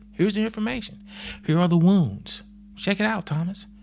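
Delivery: high, kind showing voice; gentle Jesus. Retold or imitated. Jesus showing Thomas his wounds, high and kindly. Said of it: "He just says".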